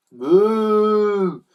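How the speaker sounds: loud, high drawn-out moo; startled cows. A narrator's reading. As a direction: bellow like a panicked cow. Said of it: "cried the cows".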